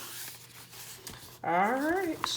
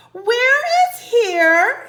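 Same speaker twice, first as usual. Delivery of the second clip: high, loud and slow; calling down; 14 semitones higher, 13 dB louder, much slower than usual. Acted. Annie calling down to the pterodon, loud and slow. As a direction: call it down from the treehouse. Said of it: "Annie called down".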